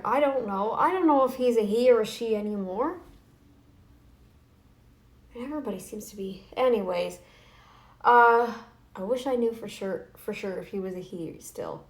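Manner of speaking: ordinary talk, nothing marked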